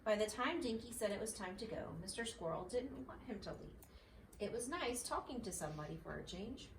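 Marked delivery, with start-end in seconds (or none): none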